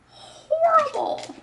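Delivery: very high, slow, loud squeal of horrible; drawn-out mock horror. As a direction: stretch out horrible in a shrill put-on squeal. Repeats in put-on voice.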